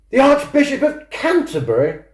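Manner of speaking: can't believe what she just heard; incredulous question. Acted. Abigail on the phone, stunned by news of the Archbishop.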